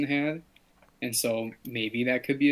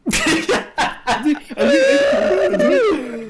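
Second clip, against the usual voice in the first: loud high burst of laughter; shrieking laugh; 12 dB louder than usual. Real, not acted. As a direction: crack up hard.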